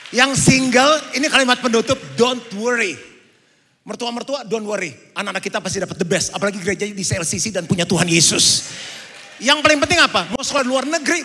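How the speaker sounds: loud, high fervent exhortation; rally the congregation to close; full-force preaching. Acted. Pastor to singles and married couples, fervent preaching.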